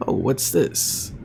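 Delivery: ordinary talk, nothing marked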